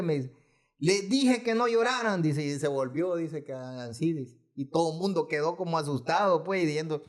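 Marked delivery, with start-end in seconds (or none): 0.8-2.2 retold once more, pitched higher; the grandmother raising her voice again, retold; raised voice. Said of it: "alzó la voz".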